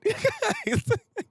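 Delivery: high burst of laughter. Real, not acted. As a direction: crack up. Laughing after punchline.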